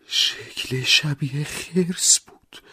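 high, very slow and shaky; trembling voice. Acted. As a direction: barely get the words out, shaking. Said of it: "با همان صدای لرزان". (صدای لرزان و خیلی آهسته)

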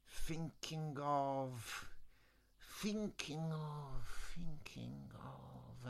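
very slow, soft, fading echo. Acted. Third wizard echoes the others, slow fading. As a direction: stretch it out and let it die away. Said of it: "the wizard in charge of echoes".

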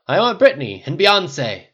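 slow, loud, high insistence; demanding. A narrator's reading. Tony choosing party music, loudly demanding. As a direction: insist like a drunk party host. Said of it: "Tony demanded".

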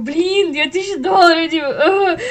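loud half-laughing wail over the price; mock despair. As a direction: whine in comic despair. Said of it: "Я такая думаю". (шуточное отчаяние)

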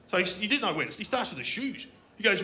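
ordinary delivery

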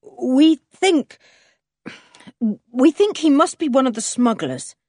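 reluctant, halting admission; awkward. A narrator's reading. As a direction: break off, then push the bad news out awkwardly. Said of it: "said Julian awkwardly".

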